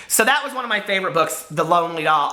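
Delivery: ordinary delivery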